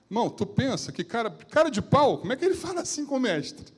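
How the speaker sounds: bem mais agudo e forte, incrédulo; espante-se com o atrevimento; indignação espantada. Real, not acted. Pregador indignado com Filipe. (pregador indignado com a ousadia de Filipe, voz aguda e alta)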